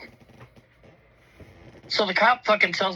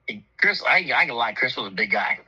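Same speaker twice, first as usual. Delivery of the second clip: normal talking voice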